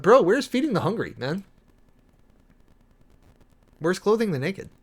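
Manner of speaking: exasperated bro-style pushback; indignant challenge. Real, not acted. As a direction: demand an answer, half-joking.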